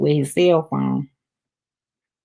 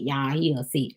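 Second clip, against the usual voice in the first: whispered aside; 5 semitones lower, 8 dB quieter than usual. Real, not acted.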